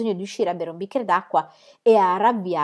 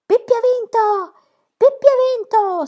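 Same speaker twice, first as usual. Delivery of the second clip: much higher, louder, slow victory shout; crowd shouting; 6 dB louder, slower than usual. A narrator's reading. The whole circus shouts Pippi's victory. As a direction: roar it from every seat. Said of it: "si gridava da ogni parte del circo".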